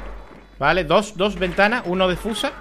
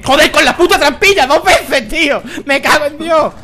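loud, higher angry outburst; furious swearing; 7 semitones higher, 7 dB louder than usual. Real, not acted. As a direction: rage at the trapdoor. Trapdoor fails twice, swearing. (gritos de rabia)

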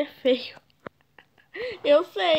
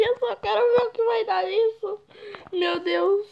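high, whimpering near-cry; high nervous whimper; whiny fear; 8 semitones higher than usual. Real, not acted. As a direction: squeak it out half scared. Nervous about chat trolls.

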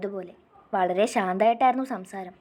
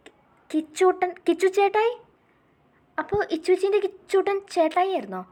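much higher and louder, stammering with delight; joyful recognition; 8 semitones higher, 4 dB louder than usual. Acted. Little girl recognising her sister's beloved brother, overjoyed. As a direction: burst out in happy disbelief. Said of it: "അവളുടെ കണ്ണുകളിൽ സന്തോഷം".

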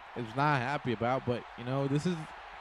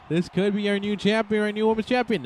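higher, louder title call; excited call; 7 semitones higher, 4 dB louder than usual. Real, not acted. Commentator calling a possible new champion, higher and louder. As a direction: build it up like the belt is changing hands.

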